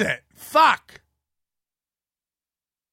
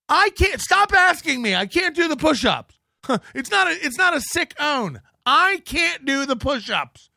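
hammed-up whining, much higher; mock whine; 7 semitones higher than usual. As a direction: whine like a cornered kid. Mock whining about push-ups.

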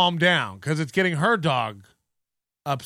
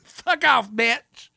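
mock outburst; 3 semitones higher, much slower than usual. Repeating the viral outburst.

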